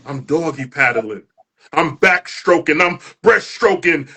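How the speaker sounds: building, rhythmic spoken-word delivery; spoken word performance. Acted. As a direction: perform it like a poem slam, rising toward the shouts.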